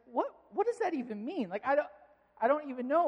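imitating a bewildered dad; mock bafflement. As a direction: protest that you have no idea. Retold dad baffled by daughter's word.

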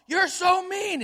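put-on high, loud child's yell; yelling from the bed; retold yell. Son yelling at his mom from bed. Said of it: "started yelling from his bed".

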